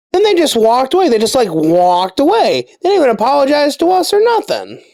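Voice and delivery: high-pitched, incredulous complaint; indignant. Real, not acted. Storyteller griping that the guards never apologized, indignant. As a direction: let the outrage push your voice up high.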